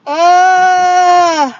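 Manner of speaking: loud, high open yawn; drawn-out yawning "uh"; big real yawn. Real, not acted. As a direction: let out a long, loud yawn. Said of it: "I'm yawning".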